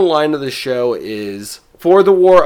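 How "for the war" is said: loud. Retold or imitated. Final line of show quoted.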